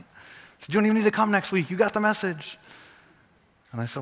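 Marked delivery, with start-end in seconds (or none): 0.7-2.6 high, quick mock congratulation; mock praise. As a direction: send them off as if they've aced it. Mock praise of the stock answer.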